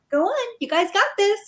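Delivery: high, cheery encouragement; breezy, upbeat pep talk; mock cheery send-off. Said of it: "they were like".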